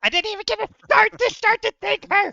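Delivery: loud, high mock complaint; indignant. Real, not acted. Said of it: "complains about being cut off".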